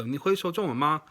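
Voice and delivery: 你会说中文吗 in native Mandarin tones, ma light at the end; a clear Mandarin question ending on a light 吗. Shown as a demonstration. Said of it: "I use the word ma to make it a question".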